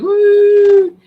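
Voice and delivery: high, loud, hammed-up crying wail; mock sobbing howl; mock ugly-cry wail. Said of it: "your face is like".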